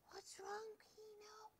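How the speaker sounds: high soft worried whisper. Acted. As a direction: ask the dog softly. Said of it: "he whispered".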